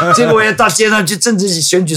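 ordinary talk, nothing marked

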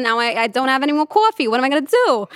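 loud, high New York street complaint; New York woman's accent. Acted. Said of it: "I'd hear this woman talking about a coffee".

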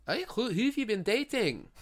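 lightly put-on Scottish lilt for Merida; Scottish accent. Merida asks Mulan about her new guy in a light Scottish accent. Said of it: "She's Scottish".

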